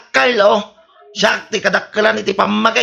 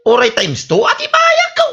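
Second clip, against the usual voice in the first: boastful outburst; 14 semitones higher, 3 dB louder than usual. Acted. Debtor boasts he'll pay double.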